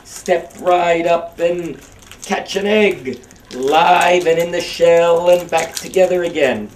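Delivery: high, loud barker's pitch, stretched slow; carnival barker. Shown as a demonstration. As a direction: hawk it like a newsboy selling papers. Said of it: "like a carnival barker".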